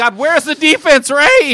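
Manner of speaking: loud, high-pitched sports-fan rant; exasperated outburst. Real, not acted. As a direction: demand an answer from your co-host, shouting. Heated challenge to co-host about defense.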